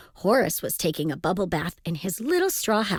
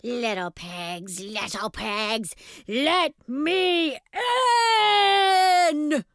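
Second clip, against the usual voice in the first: wolf's roar; 6 semitones higher than usual. A narrator's reading. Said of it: "roared the wolf".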